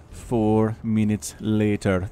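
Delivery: slow, low, drawn-out narrator voice; SpongeBob narrator. Shown as a demonstration. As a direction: draw it out like a cartoon time card. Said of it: "something my kids have picked up from SpongeBob".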